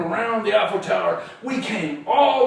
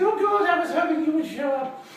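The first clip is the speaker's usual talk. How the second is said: high-pitched put-on lady's voice; high character voice for a lady client; Missus Baumgarten; 6 semitones higher than usual. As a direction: play the dog's owner, pitched well up. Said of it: "And she said".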